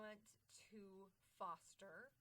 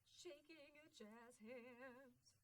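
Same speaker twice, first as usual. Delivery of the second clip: drawn-out, higher sing-song cheer; playful flourish; 4 semitones higher, 4 dB quieter, much slower than usual. Pretend. Speaker ending the mantra with jazz hands, playful drawn-out flourish. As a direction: stretch it out like a showy finish.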